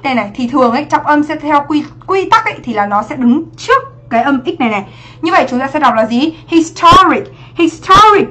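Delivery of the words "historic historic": "Historic" with the middle syllable "TOR" punched; second-syllable stress. Shown as a demonstration. Teacher showing students where stress falls, "Historic" stressed on syllable two. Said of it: "nó sẽ là trọng âm hai".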